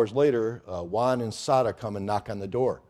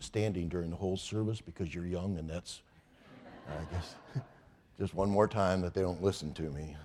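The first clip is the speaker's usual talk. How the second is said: ordinary talk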